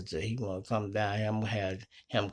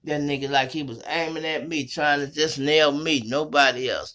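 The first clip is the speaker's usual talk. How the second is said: normal talking voice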